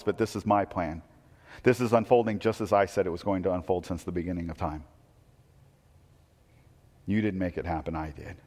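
voicing Jesus with hushed authority; hushed and solemn. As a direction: let the final words fall away quietly. Jesus voiced, dramatic climax.

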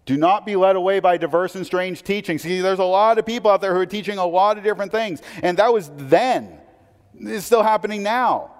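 forceful preaching. Acted. Pastor warning congregation about strange teachings, forceful preaching. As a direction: warn them off false teaching with force.

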